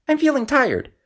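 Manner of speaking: high, small frog voice; squeaky storybook frog; little frog voice. A narrator's reading. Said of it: "he said".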